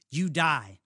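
ordinary delivery, nothing marked

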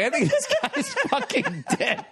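high, laughing through the words; can't keep it together; laughing disbelief. Retold or imitated. Shocked laughing at the crash.

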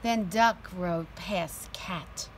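teacher reading a picture book aloud to her class; reading to children. A narrator's reading. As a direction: read it out with energy for little kids.

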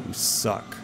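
breathy whispered "you suck"; whispered insult. Real, not acted. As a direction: hiss it under your breath. Muttering insult at Buddha.

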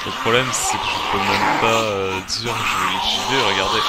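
stressed out, trapped in the bus; distressed. Real, not acted. Gamer trapped by zombies telling viewers, loud distress.

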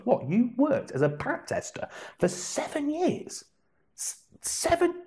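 playfully stunned, jumping up on seven; mock disbelief. Host marvels at a commenter's seven years pack testing, mock disbelief.

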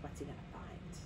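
hushed unvoiced question; whisper. Real, not acted. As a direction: whisper it like a secret. Narrator whispers to listeners.

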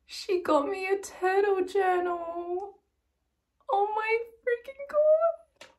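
high, drawn-out squeal of delight; overwhelmed squeal. Real, not acted. Opening turtle journal from friend, slow high squeal.

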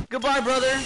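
loud, high farewell yell; gleeful shout. Real, not acted. Gamer launching his teammate away, gleeful loud yell. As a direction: send him off with a joyful shout.

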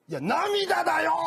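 slow, high tearful cry-out; mock tearful protest. Masseuse insisting they are tears, high and slow. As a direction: wail it through mock tears. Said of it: "おばちゃんが泣き出して".

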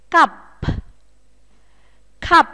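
Cup ending with a puff of air on P; aspirated P. Shown as a demonstration. Teacher models Cup for pupils, final P aspirated. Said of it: "Chúng ta sẽ có từ".

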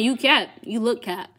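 ordinary talk

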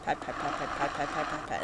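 soft, quick repeated pats; pattering imitation. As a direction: mimic footsteps under your breath. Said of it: "I still hear him pattering".

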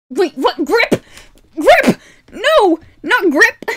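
high, loud, indignant repeats of "grip"; shocked yelling at the word "grip"; excited outcry. Real, not acted. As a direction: protest loudly that it's not "grip". Misreads the on-screen word "grip.

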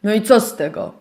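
niższy, burkliwy szczur; opryskliwy szczur. Acted. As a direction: burknij nisko. (szczur burczy na owieczkę, niżej)

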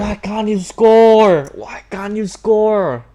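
loud, high, slow exasperated yell at the players; frustrated yell. Real, not acted. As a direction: shout your frustration at the screen. Frustrated gamer missing chances.

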